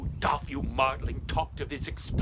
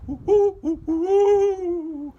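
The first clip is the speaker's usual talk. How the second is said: much higher, louder spooky moaning; ghostly wail; 11 dB louder, much slower than usual. Pretend. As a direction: moan it like a ghost in the night. Eerie ghostly sound on road.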